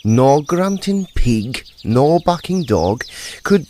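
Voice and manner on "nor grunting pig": plain reading voice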